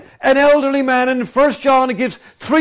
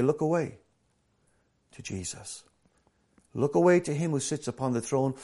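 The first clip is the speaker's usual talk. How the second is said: gentle reassurance; 6 semitones lower, 9 dB quieter than usual. Retold or imitated. Bible's gentle answer voiced.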